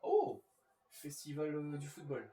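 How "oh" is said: sudden high excited shout; surprised shout. Real, not acted. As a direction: blurt out in delighted surprise. Reacts to a pack card.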